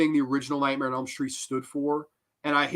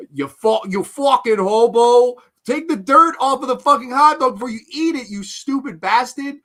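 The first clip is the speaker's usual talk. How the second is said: loud, higher, furious scolding; angry yell; 6 semitones higher, 7 dB louder than usual. Real, not acted. Reviewer yelling at the hobo to clean his hot dog. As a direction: bark it at the hobo on screen.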